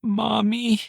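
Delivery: put-on high small child calling; tiny frightened girl voice; little girl voice. A narrator's reading. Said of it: "her daughter's voice said".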